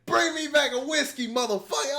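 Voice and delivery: high, loud bossy mock Ada; hammed-up boss lady telling someone off; imitating Ada. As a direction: play Ada ordering people out of her office. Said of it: "this is Boss Lady Ada".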